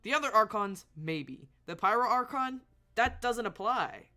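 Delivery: loud and high, indignant arguing; worked-up gamer arguing with a cutscene; heated rant. Real, not acted. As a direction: argue back hotly, stumbling at first. Heated rebuttal to the villain.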